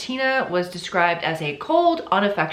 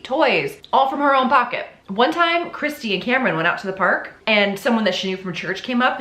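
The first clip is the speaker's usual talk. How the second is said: usual speaking voice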